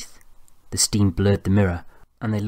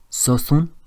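put-on French-accented question, pitched up; French accent, drawn vowels; 7 semitones higher than usual. A narrator's reading. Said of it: "He pronounced his vowels deeply".

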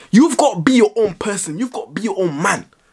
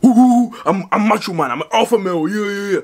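mock macho man; 3 semitones higher than usual. Mocking the macho guys he says he's not, hammed-up boast. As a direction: puff yourself up like a cartoon alpha. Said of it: "I don't go around being like".

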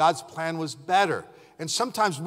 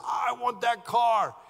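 put-on excited kid; 6 semitones higher, slower than usual. Kid wanting the car.

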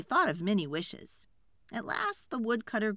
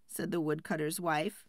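ordinary delivery

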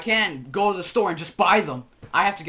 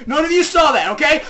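raised, higher voice, insistent; pressing, louder cover-up; mock insistence; 7 semitones higher, 5 dB louder than usual. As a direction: warn the viewers firmly. Insisting viewers forget, first take.

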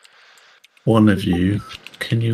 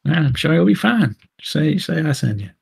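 higher Southern fixer voice, reassuring; Southern charm, waving the crew through; Southern fixer telling them to drop his name; southern accent; 7 semitones higher than usual. Acted. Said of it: "I can't get out the southern accent now".

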